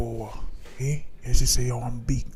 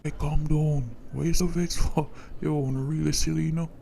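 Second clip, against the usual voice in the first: mocking; 5 semitones higher than usual. Brother mocking vexed sibling.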